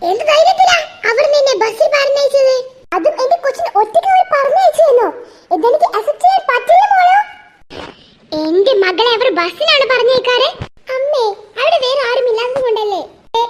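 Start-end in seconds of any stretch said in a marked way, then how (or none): 0.1-7.2 rising, louder, indignant protest; a mother scandalised her daughter came by bus; climbing higher and louder in mock outrage; outraged mother.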